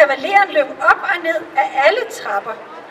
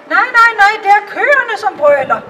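put-on higher, louder quick little girl's denial; kitchen girl correcting; 5 semitones higher, 6 dB louder than usual. The kitchen girl corrects the courtiers about the cows, higher and louder. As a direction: shake your head at the silly courtiers.

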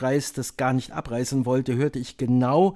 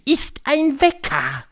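high, slow, drawn-out reveal; triumphant high witch voice; 13 semitones higher, 6 dB louder, slower than usual. A narrator's reading. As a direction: proudly unveil the secret, word by word.

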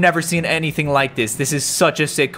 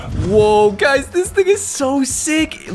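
higher and slow, savouring each word; amazed and stretching it out; slow awestruck delight; excited drawn-out; 8 semitones higher, much slower than usual. Real, not acted. Showing off the new car.

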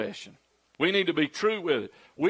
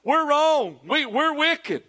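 higher, pleading Pharaoh admitting guilt; mock Pharaoh confessing; 8 semitones higher, slower than usual. Preacher voicing Pharaoh, pitched up in pleading. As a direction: play a scared Pharaoh owning up. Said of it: "That's what Pharaoh said".

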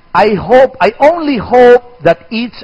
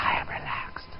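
much softer, unvoiced whisper; 21 dB quieter than usual. Shown as a demonstration. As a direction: hush it, all breath.

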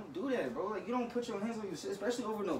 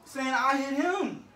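indignant protest; 7 semitones higher, 7 dB louder, slower than usual. Real, not acted. Protesting accusation.

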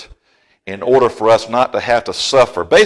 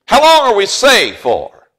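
calling out; 9 semitones higher, 5 dB louder than usual. Real, not acted. Preacher calls question to congregation.

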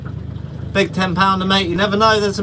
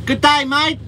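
quick Australian greeting, pitched up; Aussie accent; 8 semitones higher than usual. Pretend. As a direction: toss off an Aussie "Good day, mate". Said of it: "Say some Aussie slang".

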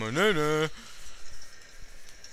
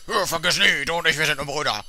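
hammed-up solemn vow, quicker; mock solemn brother; 4 dB louder than usual. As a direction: ham up a heartfelt brotherly oath. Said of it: "das hat er gesagt".

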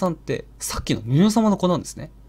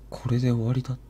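low male voice; 5 semitones lower, 5 dB quieter than usual. Retold or imitated. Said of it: "低い男の声がしたそうです".

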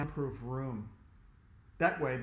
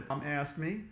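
ordinary delivery, nothing marked

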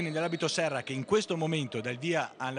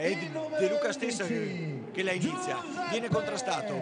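excited replay call; 9 semitones higher than usual. Real, not acted. Replay call, pitch high.

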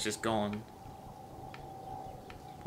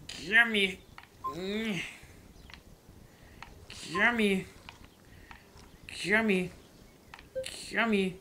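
high, repeated pleading; pleading gimme; 8 semitones higher, much slower than usual. Pretend. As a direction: beg the fish, high and whiny. Begging fish to bite.

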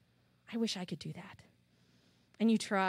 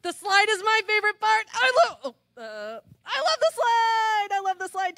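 gasping with delight, much higher and louder; excited gasp; 9 semitones higher, 7 dB louder than usual. Real, not acted. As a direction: squeal it out like the slide is the best thing ever. Teacher thrilled by favourite answer.

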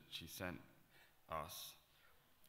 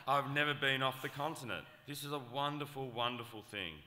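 hammed-up overjoyed mother reading; gushing email read-out; 3 semitones higher, 11 dB louder than usual. Reading Mac's mother's email aloud, loud gushing delight. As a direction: read it louder, brimming with excitement and laughter. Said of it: "She said".

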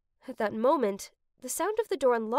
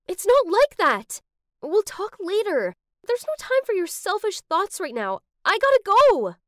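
loud, high, exasperated outburst; heated retort; 8 semitones higher, 8 dB louder than usual. Acted. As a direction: argue back forcefully, peaking on the last words. Acted quarrel, Freya rushes off.

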